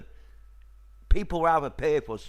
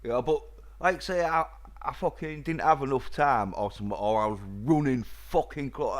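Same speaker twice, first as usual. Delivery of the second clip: usual speaking voice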